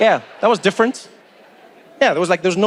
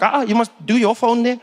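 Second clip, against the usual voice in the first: eager South African voice pitched a bit higher; South African seatmate; 4 semitones higher than usual. Retold or imitated. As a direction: play the keen local guy asking for a selfie.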